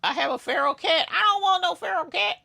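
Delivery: high, fast whiny put-on voice; mock complaining voice. Mocking a commenter about the feral cat in a high whine. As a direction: play a fussy squeaky complainer.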